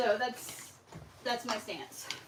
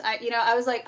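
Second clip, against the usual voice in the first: normal talking voice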